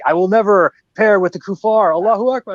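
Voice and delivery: mock jihadi zealot. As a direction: ham up a zealot's battle cry. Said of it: "the diehard isis faction going like".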